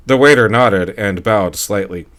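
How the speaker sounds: plain reading voice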